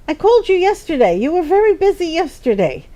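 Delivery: the new friend calling again, mock insistent; pushy new friend. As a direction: press her like an overeager new friend. New friend pressing by phone.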